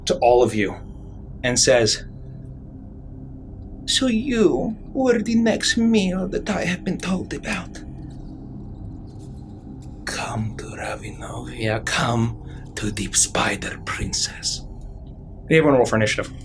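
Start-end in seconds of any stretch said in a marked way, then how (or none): 3.9-7.8 put-on high, soft voice of a monstrous little girl; sweetly menacing, sizing up her next meal; spider princess greeting. 10.0-14.6 breathy, hushed spider princess invitation; eerie whisper. Acted.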